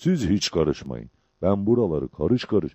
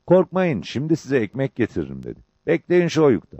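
Topal Ali; 6 semitones higher than usual. A narrator's reading. Topal Ali reassuring the others.

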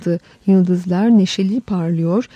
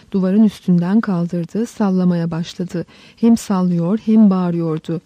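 usual speaking voice

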